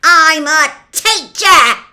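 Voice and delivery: slow, high, very loud bellow; thunderous rough-accented roar. A narrator's reading. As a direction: roar it in outrage. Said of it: "he roared at Harry".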